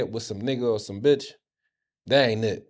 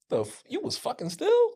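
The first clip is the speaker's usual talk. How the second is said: high-pitched incredulous question; retold shock. Telling how dad revealed another sister, acted-out shock. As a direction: replay the disbelief, voice shooting way up. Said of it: "I was shocked to meet another sister".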